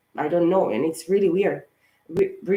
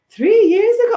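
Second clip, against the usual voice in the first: loud, much higher incredulous cry; shocked disbelief; 10 dB louder than usual. Retold or imitated. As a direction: shout it back in total disbelief. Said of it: "I was like".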